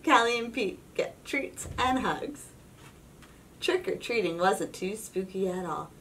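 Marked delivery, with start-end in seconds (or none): none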